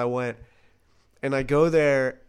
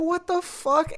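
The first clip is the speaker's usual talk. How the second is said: bewildered. Retold or imitated. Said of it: "I was like".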